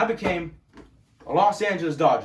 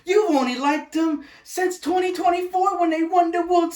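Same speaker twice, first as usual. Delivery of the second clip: mocking the haters; 11 semitones higher, 4 dB louder than usual. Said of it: "all the fucking time I get".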